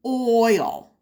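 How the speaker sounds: normal talking voice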